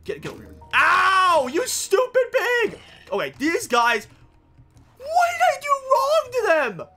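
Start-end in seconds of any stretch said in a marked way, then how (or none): 0.7-2.8 high, loud, slow yell of pain and anger. Real, not acted. Gamer hit by a pigman, yelling angrily. 5.0-6.9 high, drawn-out hammed-up wail; mock lament. Gamer hamming up betrayal by the pigmen.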